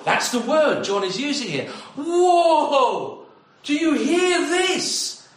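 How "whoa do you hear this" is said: much higher, slower, laughing in wonder; amazed whoa. Acted. Preacher marvelling at God's great love: laughing amazement. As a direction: gasp with delight and draw the listeners in. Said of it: "I can hardly do anything but wow".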